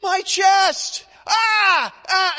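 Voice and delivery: high, shouted cry of my chest; high shouted ah, ah, loud as a man in pain; loud screaming. Retold or imitated. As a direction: yell it out like he's in agony. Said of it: "he is that loud or louder".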